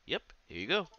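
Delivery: cheerful sidekick handing something over; chirpy young girl voice. Acted. Voicing Isako.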